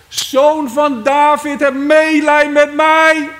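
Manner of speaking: slow, high, full-force cry. Acted. Blind beggar crying to Jesus over those hushing him, drawn-out shout. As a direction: stretch every word out in a loud plea. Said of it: "hij riep nog meer".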